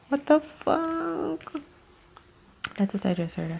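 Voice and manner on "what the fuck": slow, high, hushed disbelief; drawn-out stunned reaction; shocked. Real, not acted. Shocked at a tarot card.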